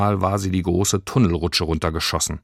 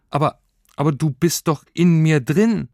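stuttered, higher-pitched protest; confused; 9 semitones higher, 4 dB louder than usual. A narrator's reading. Confused girl objects to creature being outside her, bewildered. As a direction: trip over the start, baffled. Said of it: "fragte sie verwirrt".

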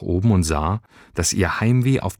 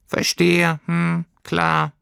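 high, subdued little creature voice; swallowing disappointment; lightly hurt, high creature; 10 semitones higher, much slower than usual. Acted. Heimweh voice.

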